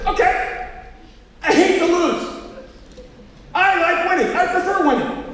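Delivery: high, loud mock outburst; hammed-up defensive admission; exasperated confession. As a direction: finally blurt it out, acting fed up. Acted blurted confession to son.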